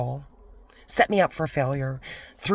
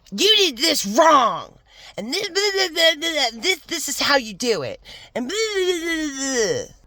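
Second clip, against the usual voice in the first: mocking jerk boss; 11 semitones higher, 5 dB louder than usual. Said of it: "And he's like".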